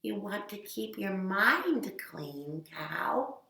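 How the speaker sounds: soft, slow calm counsel; soft slow wise guidance; gentle advice. Acted. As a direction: soothe the cow softly. Wise animal advises softly.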